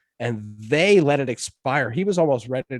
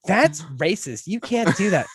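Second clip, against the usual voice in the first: imitated scolding; 6 semitones higher, slower than usual. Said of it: "And they tell me".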